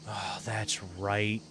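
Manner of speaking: normal talking voice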